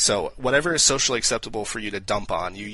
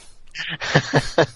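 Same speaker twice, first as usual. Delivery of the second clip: burst of high laughter; 7 semitones higher, 4 dB louder than usual. Real, not acted. Laughing after own joke punchline.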